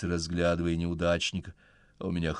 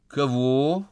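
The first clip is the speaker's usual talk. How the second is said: high, loud innocent surprise; feigned surprise; 7 semitones higher, 7 dB louder than usual. Acted. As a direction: act naturally surprised. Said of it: "очень натурально удивляется Вика".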